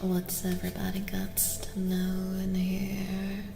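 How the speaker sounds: normal talking voice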